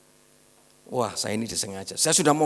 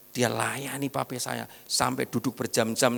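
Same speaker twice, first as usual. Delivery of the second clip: ordinary delivery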